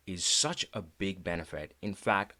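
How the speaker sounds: ordinary talk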